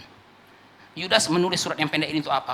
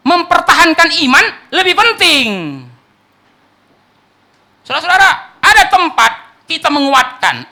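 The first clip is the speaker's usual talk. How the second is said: loud, higher, urgent sermon voice; preaching at full force; 11 semitones higher, 13 dB louder than usual. Real, not acted. Preacher pressing the church on warning versus comfort, loud and urgent. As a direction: rouse the congregation, push every line.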